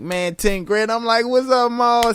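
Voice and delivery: hyped greeting. Retold or imitated.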